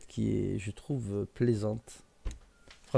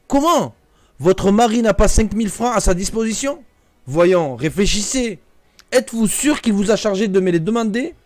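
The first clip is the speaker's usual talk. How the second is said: raised, louder astonished questions; the narrator presses his cousin in disbelief, voice raised; incredulous questioning; 6 semitones higher, 5 dB louder than usual. Acted.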